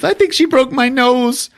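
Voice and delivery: high, whiny complaint. Retold or imitated. Podcaster voicing Grease Monkey whining, high and pitiful. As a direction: whimper about the broken nose. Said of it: "Grease Monkey says".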